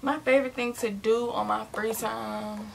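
usual speaking voice

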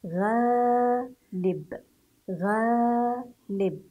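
غالب with the long ا stretched slowly; drawn-out demo. Shown as a demonstration. Teacher to pupils, modelling غالب slowly. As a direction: stretch the vowel in غالب for the class. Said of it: "كيف سنقرأ حرف الغين المفتوح مع ألف المد".

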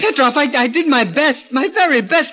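desperate pleading. Acted. Gorgo pleads with his bullying partner, high and anxious. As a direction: beg him to believe you, voice climbing.

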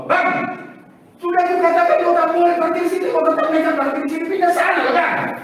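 high, loud scolding of a parking offender; angry Batak shout. Retold or imitated. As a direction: tell the driver off loudly, Batak-style. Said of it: "Pas parkir ngomong apa". (bentakan keras orang Batak kepada tukang parkir)

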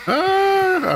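sudden high yelp; startled yelp. Real, not acted. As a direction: yelp in surprise. Startled by monster in game.

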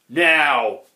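loud, burpy troll "now"; burped word. A narrator's reading. Grunt has eaten his fill, burping out the word. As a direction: belch the word out loudly. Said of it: "burped the Grunt".

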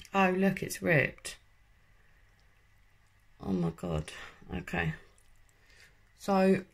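talking with mask on lips; adjusting mask while speaking; wearing a sheet face mask. Real, not acted. Seen 2: Gold sheet mask covers face; mouth hole, sheet edges near lips.

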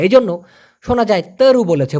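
ordinary delivery

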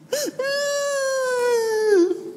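high shrieking laugh. Real, not acted. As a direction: burst into a high cry of laughter. Loud laugh after joke.

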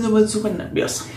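ordinary delivery

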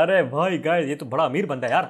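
ordinary talk, nothing marked

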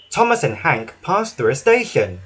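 ordinary delivery

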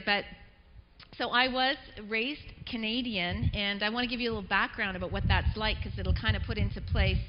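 ordinary delivery

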